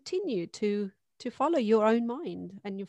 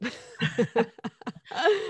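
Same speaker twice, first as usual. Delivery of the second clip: bright burst of real laughter; amused laugh, higher and louder; 5 semitones higher, 5 dB louder than usual. Real, not acted. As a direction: laugh out loud at the question. Laughs at the podcast question.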